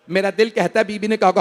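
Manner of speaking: usual speaking voice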